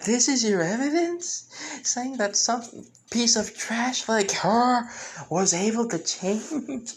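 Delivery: high, scornful villain voice, slowing at the end; mocking sneer. Aora scoffing at the traitor's change, high mocking sneer. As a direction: sneer down at him, pitched high and drawn out. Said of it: "Her pretty much laughing maniacally".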